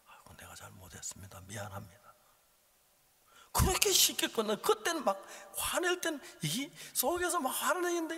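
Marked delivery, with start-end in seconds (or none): 0.1-2.1 soft whispered, humble apology. Retold or imitated. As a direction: murmur it sheepishly, under the breath. Driver apologizes in return. (속삭이는 사과)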